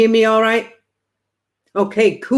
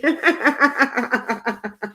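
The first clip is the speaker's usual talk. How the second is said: a soft chuckle trailing off; soft laugh; 3 semitones higher, 3 dB quieter than usual. Real, not acted. Medium laughing at the money joke, soft chuckle. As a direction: laugh it off gently.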